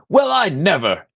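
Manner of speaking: mock-offended high-pitched cry; indignant yell. Teammate voicing Renwick storming off from the haggling, indignant yell.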